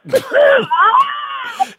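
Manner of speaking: very high, hammed-up cackle; shrieking laugh. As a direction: let out a long piercing mock-delighted squeal. Character's exaggerated shrieking laugh.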